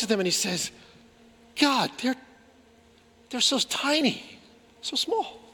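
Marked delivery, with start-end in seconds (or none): 1.6-5.4 tender pleading. Retold or imitated. Prophet pleading over small people.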